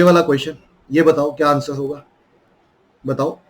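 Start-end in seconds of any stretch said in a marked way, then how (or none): none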